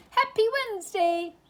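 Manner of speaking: high, fast call from afar. A narrator's reading. Pooh calling out while blown through the garden. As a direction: shout it as you fly past. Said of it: "called Pooh".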